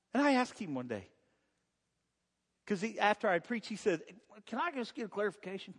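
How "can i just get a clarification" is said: put-on voice. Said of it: "he didn't talk like that or act like that".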